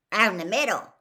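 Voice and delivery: a hint of a big gruff gamekeeper; plain, easy answer as Hagrid; lightly voiced Hagrid, matter-of-fact.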